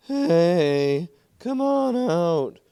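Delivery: loud, high, drawn-out shout. Acted. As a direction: yell down into the hole, stretching the words. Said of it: "shouted a young man".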